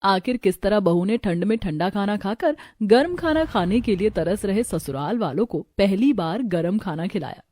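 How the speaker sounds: ordinary delivery, nothing marked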